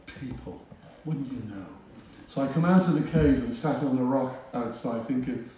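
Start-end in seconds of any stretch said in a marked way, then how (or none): 0.1-1.7 private thought. Retold or imitated. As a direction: think it under your breath. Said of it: "I thought".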